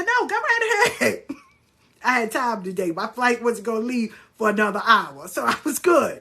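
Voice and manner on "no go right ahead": high singsong go-ahead; exaggerated obliging lilt; mock-eager invitation. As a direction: invite them on in a pitched-up voice. Eagerly inviting the stranger's reading.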